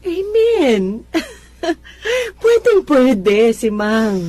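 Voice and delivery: high, loud, slow joyful amen with a laugh; joyful exclaim. Acted. Eleanor welcomes neighbor to fellowship, joyful and loud. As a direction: burst into glad praise.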